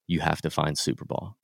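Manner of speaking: low, soft, utterly solemn; hushed mock-gravity, played straight; dead serious deadpan. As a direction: imitate a grave, deadpan order. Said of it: "like, dead serious, deadpan".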